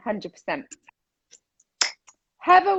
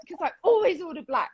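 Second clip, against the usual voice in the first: normal talking voice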